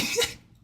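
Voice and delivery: short laugh of disbelief; amused laugh. Real, not acted. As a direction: laugh at what you just heard. Laughing at the played clip.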